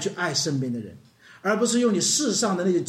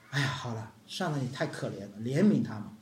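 soft, low, relenting sigh of a master; pitying master; 9 semitones lower, 7 dB quieter than usual. Acted. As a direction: let him off gently, a touch of pity.

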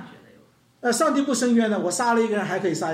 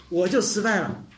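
ordinary talk, nothing marked

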